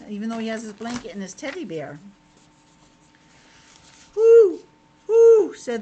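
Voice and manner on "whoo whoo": high, loud hooting owl call. Acted. Reading to kids, the owl in the night hoots loudly.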